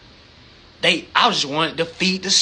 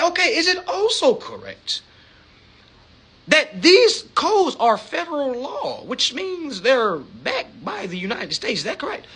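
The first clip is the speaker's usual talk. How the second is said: lebih tinggi, lambat dan tertata; penelepon resmi; 6 semitones higher than usual. Retold or imitated. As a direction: tanyakan pelan seperti pengacara santun. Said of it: "And I say".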